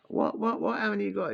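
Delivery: imitating a shocked dealer, voice shooting up; stunned disbelief.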